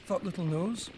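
usual speaking voice

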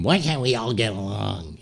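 put-on voice parroting someone's plea; mocking. Letterman mocking Leno in the commercial, mocking mimicry. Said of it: "like, mocking him".

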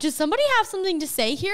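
loud, high, quick call-out; teasing question. Retold or imitated. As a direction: put the room on the spot. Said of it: "I was like".

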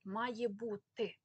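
slow, separated «має бути»; clearly articulated. Shown as a demonstration. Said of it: "це слово походить від". (повільно й чітко «має бути»)

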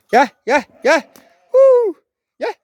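calling out. Real, not acted. Vlogger calling the animals to come, high loud herding call. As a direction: call the flock in, rising to a whoop.

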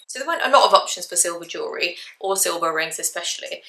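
usual speaking voice

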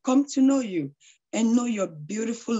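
ordinary talk

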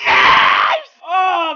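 loud celebratory scream; screamed yes. Real, not acted. Celebrating the pack.

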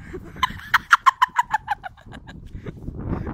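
high squealing giggle. Real, not acted. Laughing at chugging friend.

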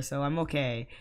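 normal talking voice

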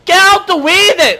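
high, loud yell to clear the way; loud gamer road rage; impatient shout. Pretend. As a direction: shout them aside. Yelling at characters in the game.

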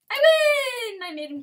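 very high, very loud triumphant yell; victory shout. Real, not acted. Player winning the round, shrieking his triumph. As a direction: scream the win.

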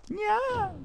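very high squeaky nya; short cartoon yowl in falsetto; mock creature cry.

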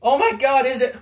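mock horrified coworker; shocked gasp. Coworker walking in on blood, shocked outburst. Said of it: "she looks and goes".

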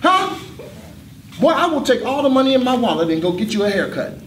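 high, loud indignant shouting; teacher yelling at a mouthy student; outraged yell. Acted. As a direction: blow up at the kid who talked back.

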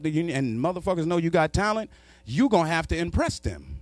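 ordinary delivery

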